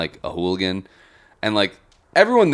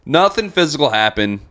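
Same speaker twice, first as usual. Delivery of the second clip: normal talking voice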